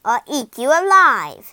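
very loud, relished threat; menacing witch threat. A narrator's reading. Witch threatens to eat the thief, loud menace. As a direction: threaten to devour them. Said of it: "she hissed".

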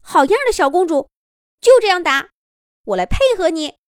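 high, loud, delighted rabbit cheer; happy cheering. A narrator's reading. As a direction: cheer on a teammate with bright energy. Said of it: "一边高兴地说".

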